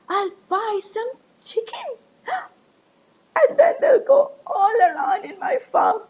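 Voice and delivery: high, eager and gasping with delight; excited daydream. Acted. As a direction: gasp with joy at the dream. Ramu excited about chickens.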